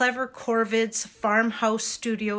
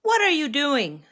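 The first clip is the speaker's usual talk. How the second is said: put-on higher, louder child's question; curious little girl; 5 semitones higher, 5 dB louder than usual. Brave little girl to the monks at their fire, curious question. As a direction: pipe up boldly, pitch lifted.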